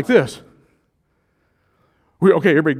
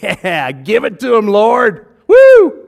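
louder and louder, higher cheering; egging on; 7 semitones higher, 14 dB louder, slower than usual. Retold or imitated. A congregant cheering Peter on, rising to a whoop. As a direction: hype it up to a whooping yell. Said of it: "that guy in the room who's going".

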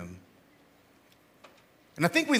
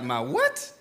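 confused reply; 6 semitones lower than usual. Retold or imitated.

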